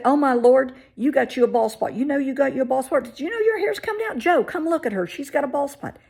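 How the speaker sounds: high, loud, gushing mock exclaim; loud tactless stepmother. Stepmother calling dad over to see the bald spot, high and loud. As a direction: blare it like a tactless busybody.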